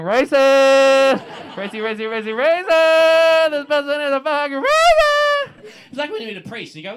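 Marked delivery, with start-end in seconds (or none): 0.0-5.7 loud, drawn-out siren-like accusation; hammed-up wailing denunciation; inner alarm. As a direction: blare it like an alarm going off in your head. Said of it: "And my head, I'm going".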